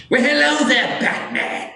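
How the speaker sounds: very high, shrieked villain greeting; Joker. Acted. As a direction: shriek the hello, then drop on the name. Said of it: "oh, I have to do it right".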